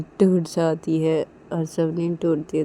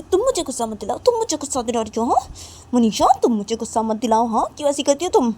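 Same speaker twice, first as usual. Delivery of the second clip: higher, fast angry whine; high, fast, raised retort; 7 semitones higher, 5 dB louder, faster than usual. Acted. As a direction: throw her anger back at her. Nisha fights back angrily.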